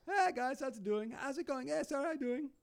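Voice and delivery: high, bright mock greeting; hammed-up Mick Blue hello; Mick Blue impression. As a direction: bounce up into a cheery guy voice. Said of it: "That's my Mick Blue impersonation".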